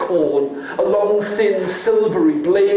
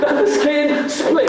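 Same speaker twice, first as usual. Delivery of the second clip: high climax; 8 semitones higher than usual. Acted. Climax of refrain.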